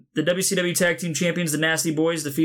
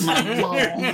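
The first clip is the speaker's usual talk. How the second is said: toothless; 7 semitones higher than usual. Pretend. Toothless gag voice.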